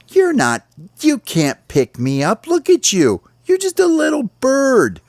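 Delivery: very high squeaky scoffing voice; mocking rabbit. Rabbit scoffs at the small bird, squeaky and high. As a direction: laugh him off in a tiny high voice.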